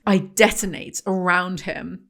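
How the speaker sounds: ordinary talk, nothing marked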